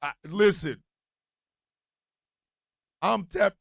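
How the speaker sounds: ordinary talk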